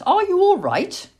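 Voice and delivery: high, gushing sympathy; sweet young lady. A narrator's reading. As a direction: fuss kindly over a fallen little creature. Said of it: "said a sweet young voice".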